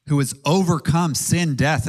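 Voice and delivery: ordinary talk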